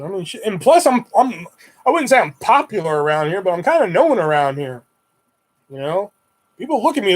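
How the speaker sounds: ordinary talk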